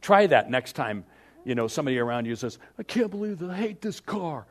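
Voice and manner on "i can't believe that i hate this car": mock whiny complaint.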